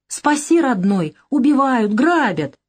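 high whining plea, hammed-up; mock pleading. Lyosha mocks rivals begging him for rescue, hammed-up whine. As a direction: mimic a frightened begging partner.

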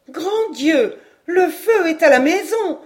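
high, loud panicked exclamation; alarmed cry. Acted. Madame Caudal jumping up at the news, crying out. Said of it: "s'écria madame Caudal".

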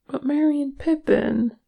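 high-pitched, unhappy protest; narrated unhappy worry, pitched well up. A narrator's reading. Fririn objects about Merry and Pippin, unhappily. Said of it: "said Fririn unhappily".

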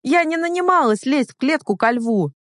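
high, indignant protest; outraged outburst, at the end of patience; losing temper. A narrator's reading. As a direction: protest hotly, pitch jumping up. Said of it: "окончательно выйдя из себя".

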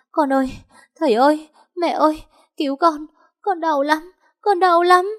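very high, pleading acted cry; agonised wailing. A narrator's reading. Injured woman calls child and parents for help, wailing in pain. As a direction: plead in pain, pitched right up. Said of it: "Chị Hạnh ôm bụng gào lên".

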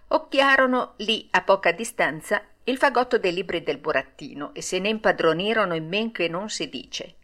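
usual speaking voice

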